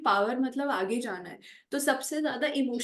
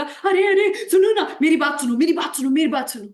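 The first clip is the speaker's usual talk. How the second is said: high, very loud pleas to be heard; frantic shouting; 4 semitones higher, 11 dB louder than usual. Acted. Acting a scared person who screams to be heard, frantic shouting. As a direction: shout over everyone like someone scared nobody will listen. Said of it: "जो इंसान जितना ज्यादा चीख चीख के बोल रहा है".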